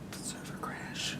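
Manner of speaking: breathy ominous whisper. Acted. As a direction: whisper it like a secret.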